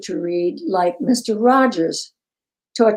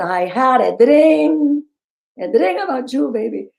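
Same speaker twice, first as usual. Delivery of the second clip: put-on Puerto Rican girl; 3 semitones higher than usual. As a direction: ham up a Puerto Rican auditioner. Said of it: "Puerto Rican girl who's auditioning".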